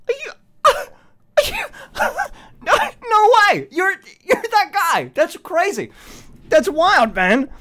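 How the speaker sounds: high, squealing disbelief; excited fan quote. Retold or imitated. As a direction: gush like a starstruck fan. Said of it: "they said".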